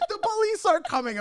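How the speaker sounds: very high-pitched group alarm; mock panic. Everyone reacting to talk of police, mock panic.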